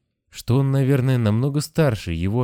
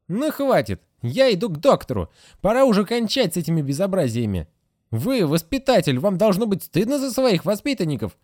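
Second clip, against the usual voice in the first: fed-up nurse; 11 semitones higher than usual. A narrator's reading. Nurse Agatha scolds the Moose before leaving, high and fed up. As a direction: blame the teacher and storm off. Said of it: "nurse storms out".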